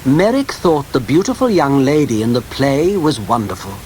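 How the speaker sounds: usual speaking voice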